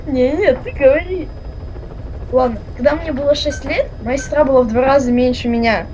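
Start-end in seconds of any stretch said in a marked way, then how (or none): none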